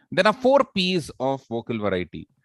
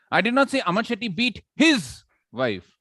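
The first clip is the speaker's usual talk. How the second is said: high-pitched build to an emphatic "his"; stress on HIS; 8 semitones higher than usual. Shown as a demonstration. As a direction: hit "his" hard, then let "wife" fall. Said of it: "somebody else's".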